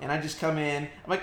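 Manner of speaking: ordinary talk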